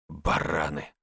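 low, curt grumble; grumbled. A narrator's reading. Andrey commenting on what he heard, grumbling low. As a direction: grumble the insult under your breath. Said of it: "буркнул Андрей".

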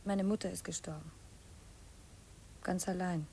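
grieving whisper. Acted. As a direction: barely breathe the words out in sorrow. Grieving news told quietly.

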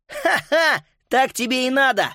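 high-pitched mocking crow; gloating. Boy watches the bulldog roll away, gloating. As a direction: crow over a beaten foe with a put-on laugh.

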